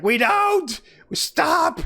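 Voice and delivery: high, strained mock yell; pained protest. Reed Richards protesting as Galactus stretches him, strained yell. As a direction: cry out high as if being stretched.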